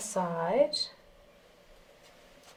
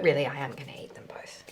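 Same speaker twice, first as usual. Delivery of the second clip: conspiratorial whisper; 8 semitones lower, 10 dB quieter, faster than usual. Real, not acted. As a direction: whisper the secret aside. Sneaky confession to camera.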